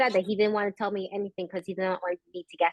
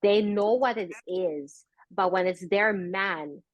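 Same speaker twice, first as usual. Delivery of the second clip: normal talking voice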